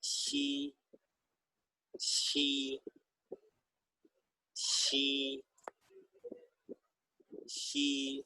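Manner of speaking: شی as a soft hissing sh with ee; light airy شی for the class; Chinese x sound. Shown as a demonstration. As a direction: breathe شی out almost unvoiced. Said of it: "شین کے ساتھ اگر آپ چھوٹی یہ لگائیں گے".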